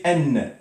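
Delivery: N said as "enn", with a sounded n; tanwin ending. Shown as a demonstration. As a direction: say N as "enn", letting the n ring. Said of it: "on doit le prononcer". (prononcer N « enn », n bien sonore)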